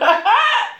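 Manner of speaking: short burst of high-pitched laughter; real high cackle. Real, not acted. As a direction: let out a high, quick laugh. Amused at moving to new channel.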